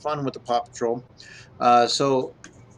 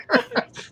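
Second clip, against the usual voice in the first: high burst of laughter. Real, not acted. Cracks up at the item.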